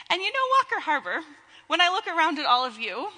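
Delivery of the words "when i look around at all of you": ordinary talk, nothing marked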